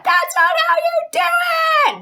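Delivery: very high, loud, drawn-out yell; acted high-pitched outburst; exploding in anger. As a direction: blow up like a furious storybook girl. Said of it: "she exploded".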